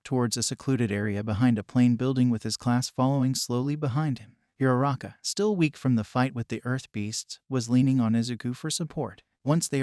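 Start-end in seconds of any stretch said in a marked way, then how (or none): none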